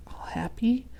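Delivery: soft whispered aside; hushed whisper. Real, not acted. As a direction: breathe it out softly.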